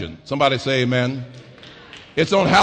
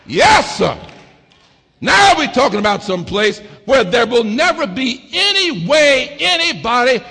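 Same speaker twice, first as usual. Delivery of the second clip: loud, climbing sermon cadence with shouted peaks; preaching at full force; 6 semitones higher, 3 dB louder than usual. Acted. Preacher rousing the congregation about the heavenly mansion, full-force preaching. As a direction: whoop it up to the congregation.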